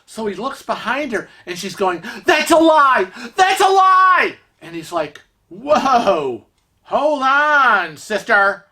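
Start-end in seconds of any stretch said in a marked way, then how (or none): 2.2-4.4 high, loud outraged shriek; hammed-up screamed accusation; mock screaming. Stranger yelling at husband in the casino, screaming. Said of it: "she starts screaming". 5.5-8.7 drawn-out, higher protest; taken aback. Retold or imitated. Husband stopping a screaming stranger, taken aback.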